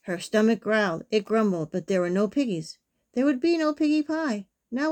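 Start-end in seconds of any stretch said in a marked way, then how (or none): none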